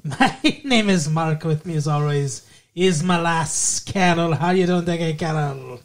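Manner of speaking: lightly put-on bad Irish accent; a hammed-up fake Irish lilt; terrible Irish accent. Host welcomes listeners in a bad Irish accent. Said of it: "terrible Irish accent".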